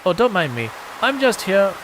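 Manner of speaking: a lightly put-on shopkeeper voice.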